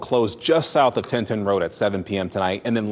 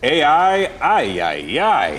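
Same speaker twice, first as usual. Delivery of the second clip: playful; 4 semitones higher, 4 dB louder, slower than usual. Acted. Pun on AI as ay ay ay.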